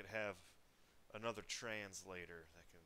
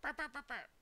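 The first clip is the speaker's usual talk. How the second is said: high, loud spoken knock noises; mock knock noises; 5 dB louder than usual. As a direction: rap out the knocks in a loud squeaky voice.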